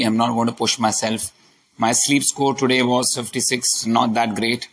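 normal talking voice

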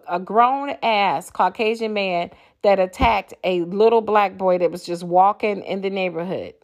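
ordinary talk, nothing marked

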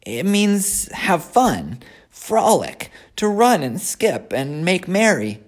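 high, whiny piglet voice; a disappointed young pig. Acted. Wilbur explains play.

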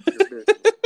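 breaks into a high laugh. Real, not acted. Laughing at the co-host's joke about sex ed. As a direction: laugh out the words.

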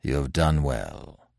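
slow, low, softened approval; sober, quiet solemnity; grave. A narrator's reading. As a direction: speak gravely, low and unhurried. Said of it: "said Manfred gravely".

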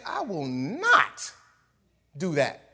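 a touch of mock defiance; stubborn refusal. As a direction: plant your feet and refuse. Peter refusing God, slow.

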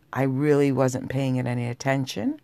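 ordinary delivery

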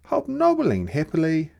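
usual speaking voice

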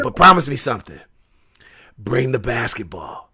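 low, hushed request; Moxley's real talk. Retold or imitated. Wrestler asking a favor of his opponent, low imitated voice. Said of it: "That's how he really talk".

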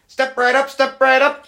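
high, loud carnival barker. Pretend. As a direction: call the crowd in like a carnival barker. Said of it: "talking like a fucking old school carnival guy".

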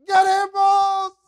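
servant wailing in despair at the surrounding army; desperate servant. Acted. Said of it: "y desesper".

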